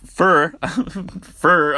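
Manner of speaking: lightly mocked Missouri drawl on fur. Shown as a demonstration. Laughing at her own Missouri slip on fur, mock drawl. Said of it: "I don't even know if that's how they speak".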